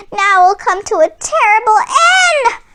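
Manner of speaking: high, loud, crying squawk of panic; panicked squawk. Acted. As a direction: shriek like frightened hens. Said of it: "they squawked".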